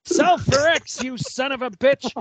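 high, loud mock-angry teacher; hammed-up exasperated math teacher; Larry Zbyszko impersonation. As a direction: yell it at the class in a put-on voice. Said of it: "terrible Larry Zbyszko impersonation".

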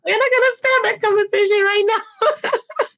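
very high, squealing laughing talk; laughing hard. Real, not acted. Host waves off a tangent with her guest, laughing hard. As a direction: talk right through a fit of laughter.

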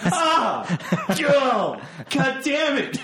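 shouting through laughter; laughing outburst. Real, not acted. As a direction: cry out while cracking up. Friend's joke lands, cries out.

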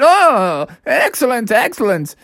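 high, pleased character voice; approving rabbit dentist. Acted.

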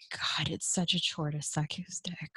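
hushed, whispery mock complaint; grumbling under breath. Imitated reluctant partner grumbling privately about a chore, under breath.

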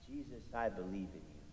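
soft, low whispered-close prayer; a soft low act of faith; quiet prayer. Shown as a demonstration. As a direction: murmur it gently to Jesus. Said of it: "Simple things".

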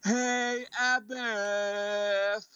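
high, drawn-out comic yell; Lou Costello. Retold or imitated. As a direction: call out to your comedy partner, stretched and high-pitched. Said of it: "Lou Costello yell".

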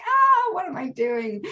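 high, slow, self-mocking. Actress mocking her own high line reading, high-pitched. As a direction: squeak it out, cringing at yourself. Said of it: "that line was, like, really high".

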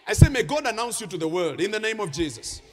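loud, fast preacher's declaration; rapid-fire, loud prophesying; forceful prophetic blessing. Real, not acted. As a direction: pronounce the blessing with urgent force. Preacher prophesies a blessing over congregation.

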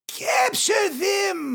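very high, slow hissing bellow; drawn-out s like a snake, bellowed. Acted. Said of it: "a hissing voice bellows".

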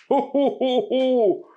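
booming merry Santa on the phone; Santa Claus. Acted.

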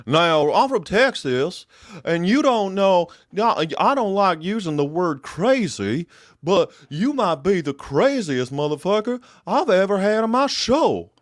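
Dr. Phil impression. Talk show host scolding his guest in a high, loud Texan voice.

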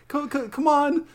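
squeaky pleading come on; high mock cheer. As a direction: egg him on in a high silly voice.

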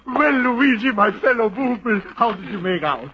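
put-on jovial German accent, broken English; a hearty German immigrant cheering up his friend; thick comic German accent. Schultz greets his fellow pupil.

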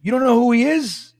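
high-pitched mock incredulous question; imitated disbelief. Friend answers the man behind in line, imitated disbelief. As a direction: act out a friend's astonishment. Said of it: "And Jason said".

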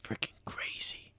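hushed whisper of amazement; awed whisper. Real, not acted. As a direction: whisper it in awe. Whispered awe.

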